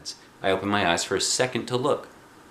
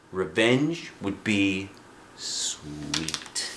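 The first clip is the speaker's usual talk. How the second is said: plain reading voice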